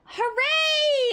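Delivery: high, loud shout of joy; cheering hooray. Real, not acted. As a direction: cheer it out, thrilled. Winning a match, cheering.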